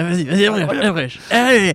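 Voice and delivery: giddy shout of go on, go on; excited urging. Real, not acted. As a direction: cheer him on, pitch jumping way up. Laughing banter urging him on.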